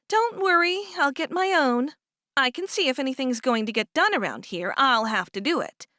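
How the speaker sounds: lightly voiced young woman, complaining; voiced younger sister. Cherish snaps at Malachi.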